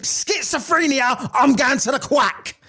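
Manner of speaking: high, drawn-out mock realisation; mock alarm. Man imagining hearing voices, mock alarmed. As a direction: announce it high and slow, hammed-up. Acts his own alarmed thought.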